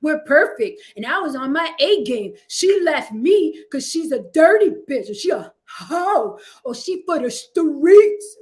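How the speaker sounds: bragging guy. Retold or imitated. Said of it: "We up here capping like".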